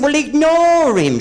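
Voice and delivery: high slow mock urgency; urging Vera in the cinema, high and drawn out; high, slow hushed advice. Talking to Vera in the pictures, high slow.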